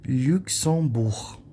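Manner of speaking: "Luxembourg" very slow, final G silent; French pronunciation demo. Shown as a demonstration. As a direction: stretch "Luxembourg" by syllable, ending on French R. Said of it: "Não pronuncia a letra G".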